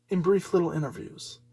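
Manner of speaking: usual speaking voice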